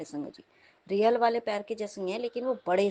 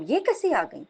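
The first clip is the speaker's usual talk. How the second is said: higher retold surprise; astonished; 6 semitones higher, 4 dB louder than usual. Dreamer wondering how the neighbour got in, astonished. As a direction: ask in disbelief, pitch jumping up.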